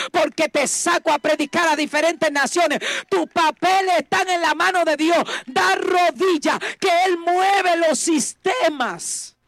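preacher voicing God's command to kneel; fervent preaching. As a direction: proclaim it as God's word.

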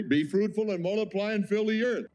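high, ringing proclamation of God's command. Acted. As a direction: boom it out as God's decree. Preacher quotes God's command.

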